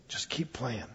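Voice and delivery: a secret word of encouragement; gentle hush. Acted. Maestro quietly encourages the little boy beside him.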